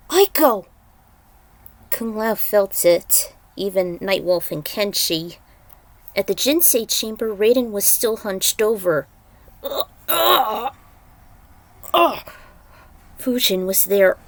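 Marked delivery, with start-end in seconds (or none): 0.1-0.7 loud high call across distance. Acted. Calling out a name. 9.6-12.3 high strained groans of pain. Acted. Raiden groaning hunched over.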